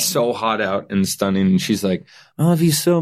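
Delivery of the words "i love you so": higher, lightly voiced girlfriend; a touch of her sweet voice; girlfriend's voice. Girlfriend's tender speech voiced.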